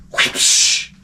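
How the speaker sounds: unvoiced splashing noise; imitated whoosh. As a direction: make a quick airy throwing sound. Mimes throwing coffee at crawler.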